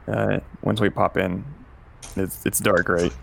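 ordinary talk, nothing marked

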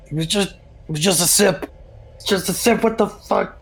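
high, cracking voice of disbelief; high broken whimper over the dead bird; grieving disbelief. Acted. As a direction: cradle the body and plead it was only a sip. Player grieving over dead pet.

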